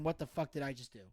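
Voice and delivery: low, hushed aside; a stunned private thought, very soft; quiet regretful realization; thinking to oneself. Retold or imitated. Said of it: "And I'm thinking".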